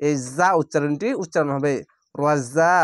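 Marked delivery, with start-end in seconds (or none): 2.1-2.7 র জা with a buzzing, ringing z sound; tajweed zay sound. Shown as a demonstration. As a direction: make the জা in র জা buzz. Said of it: "এই ঝিনঝিন করে আওয়াজটি".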